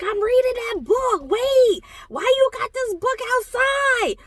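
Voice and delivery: high, loud, thrilled squeal of surprise; excited disbelief. Retold or imitated. Spotting her favourite book on a street table, thrilled high squeal. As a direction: squeal it like you just spotted a treasure.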